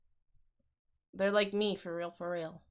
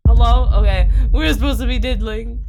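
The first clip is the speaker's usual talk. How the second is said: calling out; 11 dB louder than usual. Real, not acted. Calling out to chat.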